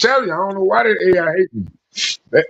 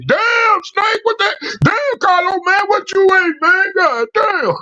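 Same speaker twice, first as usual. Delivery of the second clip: high shocked bystander voice, much higher; bystanders at the pump; 12 semitones higher, slower than usual. Retold or imitated. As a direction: squeal it like a shocked onlooker. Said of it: "They were like".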